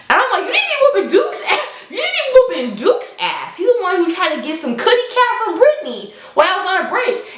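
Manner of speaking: high, fast, laughing disbelief; laughing indignant retort. Retold or imitated. Narrator scolding her furious friend, laughing in disbelief. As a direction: fire it back high through laughter.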